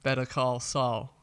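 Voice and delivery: "Better Call Saul" slow, with an American flapped T in "Better"; American accent. Shown as a demonstration. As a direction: drawl the title like an American. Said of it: "easier to say it in an American accent".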